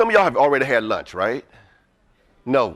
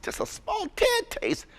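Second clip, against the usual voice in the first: drawn-out tad; 10 dB quieter, slower than usual. Real, not acted. Speaker stretches word tad.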